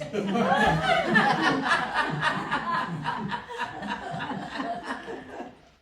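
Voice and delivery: loud high burst of laughter; big laugh. Acted. Young man laughing loudly to the Duke. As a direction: roar with laughter.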